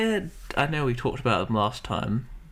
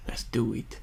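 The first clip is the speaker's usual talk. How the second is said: soft unvoiced whisper; 4 dB quieter than usual. Real, not acted. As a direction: breathe it out in a whisper. Quiet hushed start.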